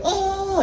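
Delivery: high falsetto stage yelp. Acted. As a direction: mimic a pop star's sudden high cry. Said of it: "grab his balls and shout".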